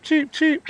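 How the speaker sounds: high, light chirped cheeps; imitated quail cheep. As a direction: peep it like a calm hen quail. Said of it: "hear her go cheep".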